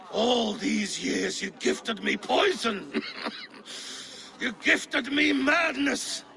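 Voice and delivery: slow, growing bitter outrage; bitter accusation. Acted. Poisoned king accusing his old friend, bitter. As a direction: let the betrayal sink in, then rise.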